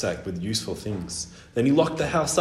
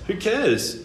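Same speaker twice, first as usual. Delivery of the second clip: high-pitched offhand bird; dismissive; 9 semitones higher than usual. A narrator's reading. Albatross shrugging at boy's question, dismissive.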